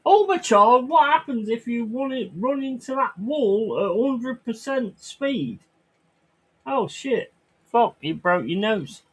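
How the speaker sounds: hammed-up dad scolding an imaginary child; mock dad voice. Dad scolding his kid after a crash, mock sing-song.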